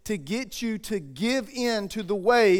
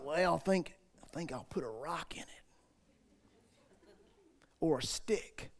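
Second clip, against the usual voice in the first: low, very soft mumbled musing; clueless mouse hunter; 6 semitones lower, 14 dB quieter than usual. Acted. Preacher imagining someone baiting a mousetrap with a rock, mock-pondering quietly. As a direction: think aloud like a hapless trapper, hushed and low. Mock quote of a foolish trapper.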